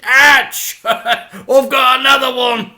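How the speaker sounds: loud high yelp, laughing. A narrator's reading. Dad on the crate, loud laughing ouch. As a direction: yell it with a laugh.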